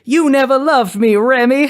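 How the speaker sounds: put-on high Southern belle accent, accusing; Belladonna. Abandoned bride accuses her husband, high Southern accent. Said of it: "so she comes out and she says".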